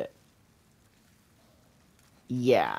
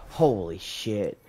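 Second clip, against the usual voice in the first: ordinary talk, nothing marked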